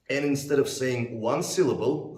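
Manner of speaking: ordinary delivery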